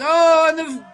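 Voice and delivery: loud, high "oh" breaking off the thought; real surprised commentator cry; startled outburst. Real, not acted. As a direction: react suddenly to the action, voice leaping up. Commentator reacts to drop mid-thought.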